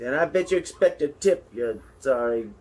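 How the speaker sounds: high, loud, hammed-up scolding; mock outrage. Commentator scolding the mouse about tips, mock outrage. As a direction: scold like a grumpy customer. Continuing comic rant at mouse.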